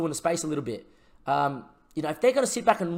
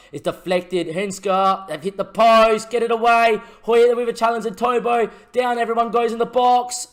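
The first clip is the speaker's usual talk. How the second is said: louder, higher, frantic near-miss call; panicked commentary; 8 semitones higher, 9 dB louder, slower than usual. Real, not acted. Watching Stoke hit the post, the manager panics, frantic shout. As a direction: yell it out as the ball hits the post. Stoke nearly score late on.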